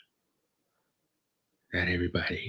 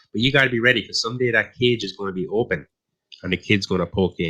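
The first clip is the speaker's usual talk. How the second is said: ordinary delivery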